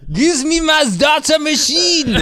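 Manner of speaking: put-on high, loud, drawn-out German-accent shout; hammed-up German yell, very slow and laughing; mock German accent. Podcaster plays laptop man for co-hosts, shouted mock German accent. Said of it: "Do it in a German accent then".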